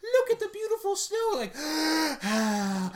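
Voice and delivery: high, loud, gasping mock awe; gushing mock wonder. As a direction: marvel aloud like an enchanted onlooker, gasping between phrases. Acting out people loving asbestos snow.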